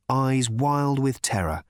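usual speaking voice

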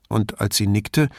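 ordinary talk

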